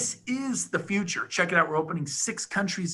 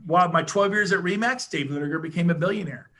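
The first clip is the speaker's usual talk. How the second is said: ordinary talk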